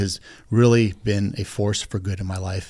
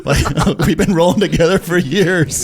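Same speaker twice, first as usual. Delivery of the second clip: amused retelling; 5 semitones higher, 4 dB louder than usual. Retold or imitated. Retelling the mix-up.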